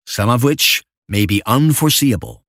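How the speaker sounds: usual speaking voice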